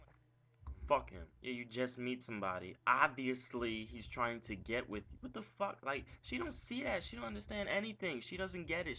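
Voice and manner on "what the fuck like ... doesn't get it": high-pitched, incredulous rant; can't believe she doesn't see it; exasperated disbelief. Real, not acted. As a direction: voice climbing higher in frustration. Ranting about his ex.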